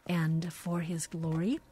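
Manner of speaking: ordinary talk, nothing marked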